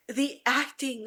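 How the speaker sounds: normal talking voice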